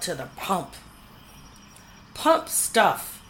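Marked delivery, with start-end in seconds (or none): 2.2-3.2 higher, louder disgusted horse voice; shuddering horse. A narrator's reading. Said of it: "shuddered Brutus".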